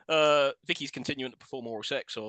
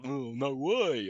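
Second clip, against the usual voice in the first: slow, drawn-out scoff; exaggerated dismissive groan of refusal; mock eye-rolling dismissal; 2 semitones lower, much slower than usual. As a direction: drag it out with an imitated eye-roll. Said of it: "rolling his eyes".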